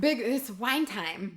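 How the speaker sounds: slow, odd comic drawl; slowed-down comedy voice; Fred Armisen impression. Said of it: "You sound like Fred Armisen".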